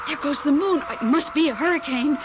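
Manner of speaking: ordinary delivery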